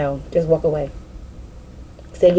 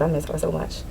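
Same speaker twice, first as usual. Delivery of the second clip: grieving; 3 semitones lower, 10 dB quieter than usual. Real, not acted. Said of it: "I'm having a moment".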